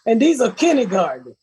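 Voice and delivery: usual speaking voice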